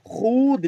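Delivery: "खुद" with a long drawn-out oo, khood; spelling pronunciation. Shown as a demonstration. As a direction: stretch the vowel of "खुद" as if reading the waw. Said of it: "लिखा जाता है".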